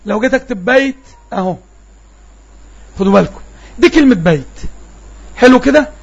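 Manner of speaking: normal talking voice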